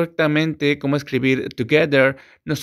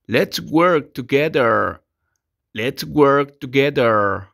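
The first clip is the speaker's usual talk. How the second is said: slow, clear "Let's work together"; slow teaching model; much slower than usual. Shown as a demonstration. Teacher models the English phrase slowly for students. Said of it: "se dice".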